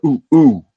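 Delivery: imitated monkey oohs, high and loud; two loud retold ape hoots. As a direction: hoot like a monkey. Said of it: "trying to be like a monkey".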